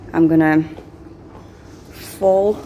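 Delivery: usual speaking voice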